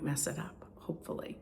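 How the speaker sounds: breathy whispered aside; barely voiced, hoping under breath. Real, not acted.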